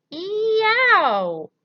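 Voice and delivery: long, high howl of pain; roar. A narrator's reading. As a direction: roar out at full volume in terror. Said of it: "roared the flesh lump eater".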